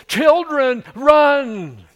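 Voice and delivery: loud, high-pitched panicked shout; urgent full-voiced warning cry; fearful yell. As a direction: yell it out like an alarmed mother. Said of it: "In fear, she yells".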